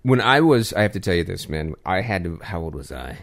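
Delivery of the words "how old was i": whispered aside. Real, not acted. Aside while recalling his age.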